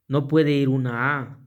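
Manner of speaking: ordinary talk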